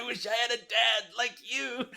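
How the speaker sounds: high, laughing imitation of the clip; playful quote of the movie line; quoting Rufio clip. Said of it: "The clip of Rufio?".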